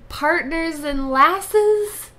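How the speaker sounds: loud, slow cheerful country twang; farm gal voice. Pretend. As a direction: drawl it out like a ranch girl. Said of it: "You sound like a farm girl".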